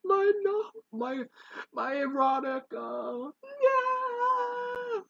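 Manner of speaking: very high, hammed-up sobbing lament; mock wailing. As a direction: wail pitifully over your lost treasure. Mock wailing over burnt naughty stories.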